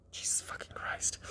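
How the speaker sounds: exasperated whispered aside. Real, not acted. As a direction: breathe it out under your breath in disbelief. Aside while reading absurd text.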